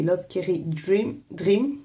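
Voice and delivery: Dream said the French way, "dim" with a flat French r; French-accented brand name, slightly slow; strong French accent. Shown as a demonstration. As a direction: say Love Carry Dream with a heavy French accent. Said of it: "l'accent bien français".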